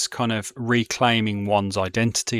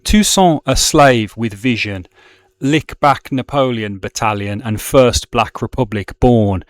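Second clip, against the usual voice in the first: rousing poetry reading; 7 dB louder than usual. Shown as a demonstration. Teacher reading the Toussaint verse to the class, proudly declaimed. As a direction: declaim it like a tribute to a hero. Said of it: "I will just read it in my, my Brummie accent as best I can".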